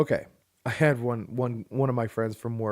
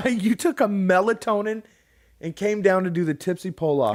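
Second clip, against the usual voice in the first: incredulous teasing; 7 semitones higher, 4 dB louder than usual. Real, not acted. Teasing disbelief at wife.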